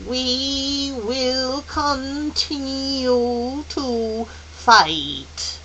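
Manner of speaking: otherworldly elder's slow decree; solemn pronouncement. Acted. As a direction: stretch every word with resolve. Mrs Which's solemn, drawn-out answer.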